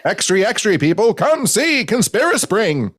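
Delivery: put-on high, booming old-time announcer; old newsreel voice. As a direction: sell it like a vintage newsreel. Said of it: "That voice is the voice of all the fonts".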